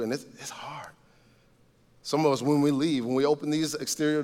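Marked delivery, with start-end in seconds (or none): 0.0-0.9 whispered. Retold or imitated. Voicing a struggling listener.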